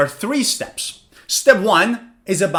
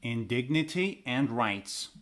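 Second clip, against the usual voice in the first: shadowing demo; 6 semitones lower, 12 dB quieter than usual. Shown as a demonstration. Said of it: "Step two, lesson, pause, and repeat".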